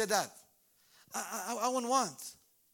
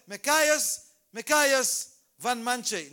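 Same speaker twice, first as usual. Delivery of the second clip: louder second call of the name; calling out twice; 8 dB louder, much slower than usual. Shown as a demonstration. Calling a boy who doesn't hear, loud repeat. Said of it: "So you say".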